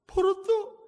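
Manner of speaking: high, squeaky frightened admission; scared confession. Retold or imitated. Daughter admits to her mother in a high, scared squeak. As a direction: squeak it out like a caught child.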